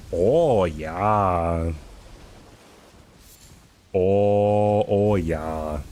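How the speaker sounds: put-on a light Minnesota Fargo accent, drawn-out oh yeah; Fargo Midwestern accent. Streamer mimics the Fargo movie accent, lightly. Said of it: "If you've ever seen the movie Fargo".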